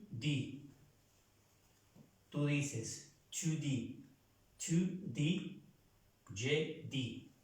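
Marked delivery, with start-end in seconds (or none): 3.3-5.6 "Tu dis" said the French way, final s silent; careful French "Tu dis" for a student; French pronunciation. Shown as a demonstration. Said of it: "No pronunciamos estas terminaciones".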